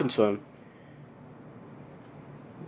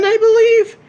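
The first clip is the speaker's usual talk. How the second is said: high, louder questioning yelp; unsure squeak; 6 dB louder than usual. Real, not acted. Vlogger unsure of a race statistic, high doubtful yelp. Unsure about a safety-car fact.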